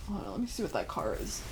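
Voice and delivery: hushed, quick, half to yourself; distracted aside. Real, not acted. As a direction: mumble it off to yourself. Aside while checking on a car.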